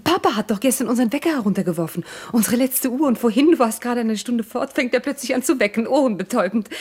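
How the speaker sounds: fast, excited account of household news; eager storytelling. Acted. Wife eagerly tells the clock story.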